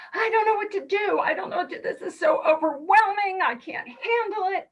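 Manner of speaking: high, fast, panicky voice of being overwhelmed. Retold or imitated. Retelling her own meltdown when angry, high and panicked. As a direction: spill it out in a rising panic. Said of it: "I'm like".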